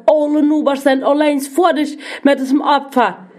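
enraged father berating his disobedient son; angry yelling. A narrator's reading. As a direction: rant at the son in rising anger. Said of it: "Der brellt".

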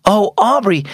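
a sudden high "Oh, Aubrey"; sudden exclaim. A narrator's reading. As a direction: catch yourself, suddenly remembering. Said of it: "Mom suddenly exclaimed".

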